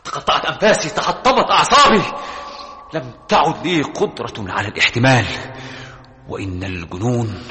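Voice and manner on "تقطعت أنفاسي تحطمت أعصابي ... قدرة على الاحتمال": frantic outcry. Acted. Breaking down.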